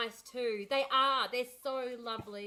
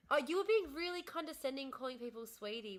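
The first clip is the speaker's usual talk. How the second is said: ordinary talk, nothing marked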